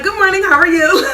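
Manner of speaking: high and loud, bursting with nervous energy; hyped greeting. Retold or imitated. Nervous test taker greets the proctor, over-excited. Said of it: "Like, I was just so hyped".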